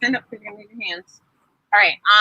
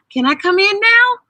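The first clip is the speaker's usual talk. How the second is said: put-on high, louder girlish voice; little sister; 9 semitones higher, 5 dB louder than usual. Sister Debbie asking her brothers to come in, high voice. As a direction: ask brightly as a little girl.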